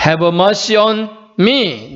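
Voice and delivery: "Have mercy on me" very drawn out; slow quotation. Shown as a demonstration. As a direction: say "Have mercy on me" word by word, slowly. 영어 기도 문구를 천천히 보여줌. ("Have mercy on me"를 아주 천천히 끊어서)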